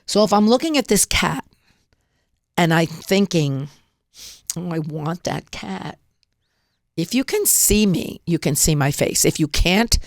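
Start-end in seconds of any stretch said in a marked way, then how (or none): 4.6-6.0 soft, slow longing sigh of want; yearning. Shown as a demonstration. Demonstrating the wrong way to a viewer, soft and yearning. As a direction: say it like something out of reach. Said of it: "I'm gonna show you what I mean".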